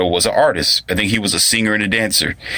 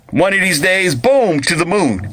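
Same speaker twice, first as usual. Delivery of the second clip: hammed-up blustering catchphrase; Honeymooners husband; 9 semitones higher than usual. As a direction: threaten like an old sitcom husband. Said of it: "like the, the, the honeymooners".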